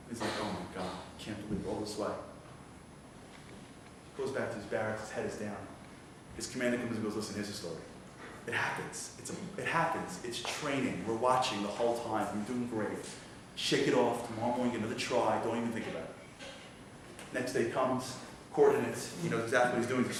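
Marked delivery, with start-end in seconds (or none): 0.4-2.1 dejected sigh. Retold or imitated. Defeated after failing test. 7.2-16.1 reassuring. Retold or imitated. Commander consoling the recruit.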